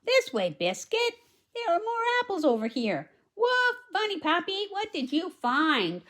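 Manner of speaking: put-on bright, high read-aloud voice for the little girl; high storybook girl. Reads girl's lines.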